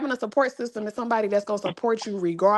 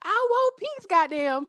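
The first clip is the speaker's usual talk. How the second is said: exasperated plea; 10 semitones higher, slower than usual. Retold or imitated. Retells her exasperated plea.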